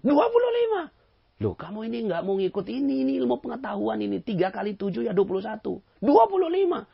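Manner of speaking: very high, loud bickering; two stubborn men yelling numbers; shrill shouting argument. Retold or imitated. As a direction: yell back and forth. Escalating argument, both voices high.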